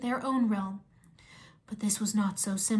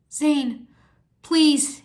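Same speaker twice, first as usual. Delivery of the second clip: louder, urgent plea; begging; 4 semitones higher, 8 dB louder than usual. A narrator's reading. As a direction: beg him to stop. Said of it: "he begged".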